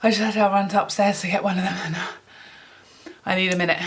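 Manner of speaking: winded, with long pauses for air between phrases; out of breath. Real, not acted. Vlogger who just ran upstairs, out of breath. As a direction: catch your breath as you talk. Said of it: "I'm so out of breath".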